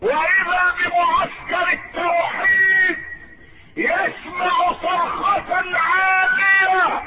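very high, impassioned sermon shout; full-force preaching. Real, not acted. Preacher announces Ali's victory to the congregation, triumphant high-pitched preaching. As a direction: cry out the climax, falling on the last words.

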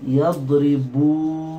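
يضربون spoken very slowly, each syllable spaced; slow model word; slow demo. Shown as a demonstration. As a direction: stretch out يضربون. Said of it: "فماذا صارت الكلمة؟".

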